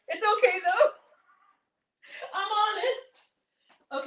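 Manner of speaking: high giggly confession, slow; laughing. Real, not acted. Laughing to viewers about using extra butter. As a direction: laugh through it, a little teary.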